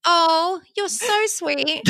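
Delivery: high, very slow and tender; tender reply. Retold or imitated. Mom answering her little son, high and doting. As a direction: melt at the child's sweetness.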